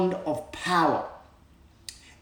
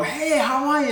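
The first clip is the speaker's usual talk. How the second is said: cheery greeting; 11 semitones higher than usual. Retold or imitated. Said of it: "and I go".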